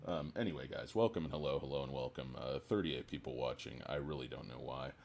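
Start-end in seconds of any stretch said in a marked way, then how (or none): none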